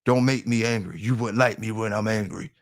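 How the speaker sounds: a half-hearted Hulk growl, dropping soft; mock Hulk warning to the chat; Hulk impression. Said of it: "I'ma tell that shit like the Hulk".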